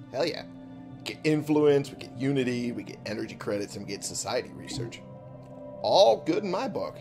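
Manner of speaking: ordinary talk, nothing marked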